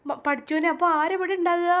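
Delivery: high, surprised cry; startled dismay. Acted. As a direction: caught off guard, voice jumps up. Sudden dismay at being left. (ഞെട്ടലോടെ ഉയർന്ന സ്വരം)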